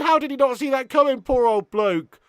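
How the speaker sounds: usual speaking voice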